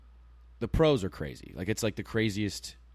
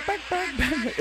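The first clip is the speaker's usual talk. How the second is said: announcer call; 3 dB louder, slower than usual. Retold or imitated. Home run call imitation.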